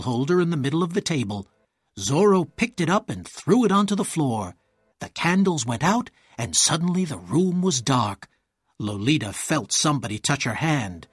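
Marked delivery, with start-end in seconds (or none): none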